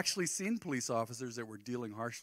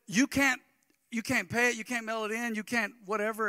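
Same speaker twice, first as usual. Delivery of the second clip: higher, louder mocking clerk voice; 9 semitones higher, 7 dB louder than usual. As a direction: rattle off the rules like a snippy clerk. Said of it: "So they said".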